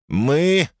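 put-on high, slow, taunting American accent; mocking American accent. Caller in Dickie voice.

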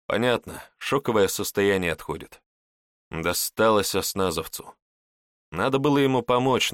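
ordinary delivery, nothing marked

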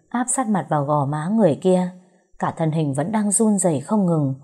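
ordinary delivery, nothing marked

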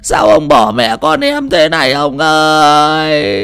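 slow lamenting wail, fading to a broken whisper; wailing widow. Acted. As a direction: mourn her husband, drawing each cry out. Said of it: "bà ôm mặt khóc rưng rức". (khóc than kể lể, chậm, nhỏ dần)

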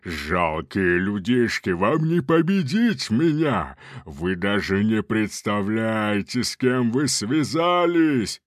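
slow, menacing demonic taunt; booming arrogant alien overlord. Acted. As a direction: gloat down at puny humans.

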